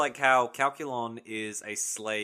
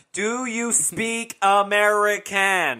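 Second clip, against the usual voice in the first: slow, louder, higher, over-careful American; mock slow talk to a foreigner; slow mock accent; 7 semitones higher, 7 dB louder, slower than usual. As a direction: stretch every word out for a foreigner. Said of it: "It had that slow".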